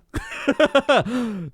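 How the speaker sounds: high burst of real laughter; amused laugh. Real, not acted. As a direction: crack up laughing. Amused by the lady swing animation.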